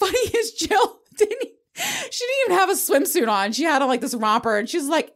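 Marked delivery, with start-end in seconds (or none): 0.0-3.5 much higher, giggling through the words; laughing high and a bit louder. Real, not acted. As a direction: tell it while cracking up. Laughing at Jill swimming.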